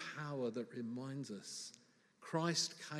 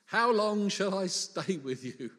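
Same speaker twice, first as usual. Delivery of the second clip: loud, drawn-out plea at higher pitch; exasperated Jesus; 5 semitones higher, 9 dB louder than usual. Acted. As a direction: stretch it out, at the end of your patience. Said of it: "a certain amount of sympathy when Jesus says".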